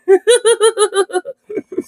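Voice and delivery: high, wheezy staccato laugh; helpless giggling. Real, not acted. Streamer tickled by the good old piggy line, helpless laughter. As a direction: giggle till out of air.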